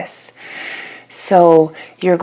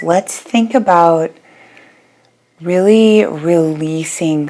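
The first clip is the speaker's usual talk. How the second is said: unhurried yoga nidra guidance with long pauses; guided meditation. Real, not acted. Yoga teacher guiding listeners to settle their bodies, calm guided meditation. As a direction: ease them into rest, phrase by phrase, leaving long silences.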